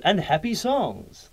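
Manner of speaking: "happy songs" high and drawn out; emphatic demo. Shown as a demonstration. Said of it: "with the, with the emphasis".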